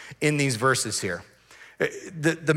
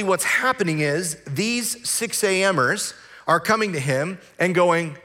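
normal talking voice

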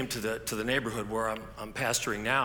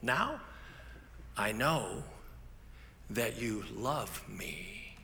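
slowing right down and fading soft; tender realization; 6 dB quieter, much slower than usual. Retold or imitated. Derek admitting to the pastor he was loved, soft and slow. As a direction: let it land quietly, with feeling. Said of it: "He goes".

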